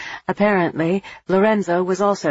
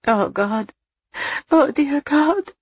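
high, slow, horrified moaning; a mother horrified at the news; 10 semitones higher, slower than usual. A narrator's reading. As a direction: moan it in horror. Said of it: "Julia moaned in horror".

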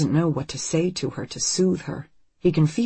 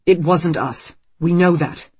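louder, fierce insistence; 3 semitones higher, 7 dB louder than usual. A narrator's reading. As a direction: say it fiercely, defending. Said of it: "Marco says fiercely".

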